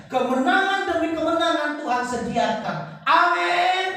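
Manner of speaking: loud, emphatic pulpit delivery; rousing sermon proclamation; forceful preaching. Real, not acted. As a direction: preach it out loud, building to a ringing Amin.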